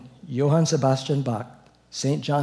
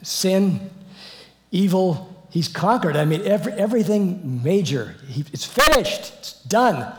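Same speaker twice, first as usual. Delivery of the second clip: rising fervor; 5 semitones higher, 4 dB louder than usual. Real, not acted. Preaching climax on the victory.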